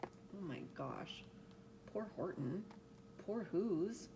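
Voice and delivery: soft, sorrowful aside; quiet dismay for the characters; hushed pity. Real, not acted. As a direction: murmur it quietly in sympathy. Reader pities the story's characters.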